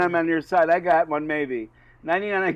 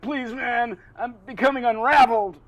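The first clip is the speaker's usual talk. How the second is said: high-pitched comic pleading; desperate plea; 7 semitones higher, 5 dB louder than usual. Acted. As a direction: plead like a mummy coming apart. Mummy pleads with the bartender.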